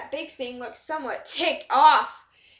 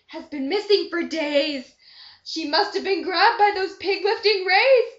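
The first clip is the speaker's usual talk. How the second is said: loud, urgent raised voice; loud alarmed cry; 4 semitones higher, 7 dB louder than usual. Acted. Lorna May Loon warns everyone that Mabel was grabbed, loud and alarmed. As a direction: call it out in a panic. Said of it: "she cried loudly".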